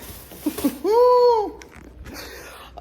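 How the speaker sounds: squealing high giggle; high giddy laugh. Real, not acted. Thinking about the instructor's voice, a high giddy laugh.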